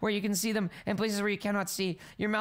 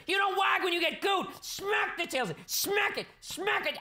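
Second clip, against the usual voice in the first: mock scolding; 11 semitones higher, slower than usual. Player scolding her wagging dragon tail, high mock anger. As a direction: scold the tail like a naughty dog.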